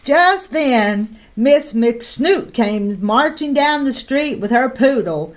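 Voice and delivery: usual speaking voice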